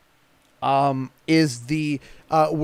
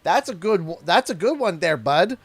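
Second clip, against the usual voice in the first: light Canadian lilt, falling on bud; easygoing Canadian buddy talk; Canadian accent demo; 3 semitones higher, 4 dB louder than usual. Shown as a demonstration. Showing how Canadians pass the turn, a light Canadian lilt. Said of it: "the A is a natural higher pitch".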